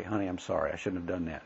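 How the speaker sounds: quiet, understated apology; soft apology. Acted. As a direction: apologize softly, letting it drop away. Models a wife's apology.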